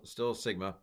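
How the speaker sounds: normal talking voice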